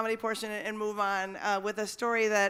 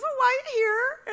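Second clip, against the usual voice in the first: much higher, louder confused lady voice; puzzled woman; 7 dB louder than usual. Retold or imitated. Woman at the door asks the canvasser why he came, high and baffled. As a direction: act baffled after letting him in. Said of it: "and she goes".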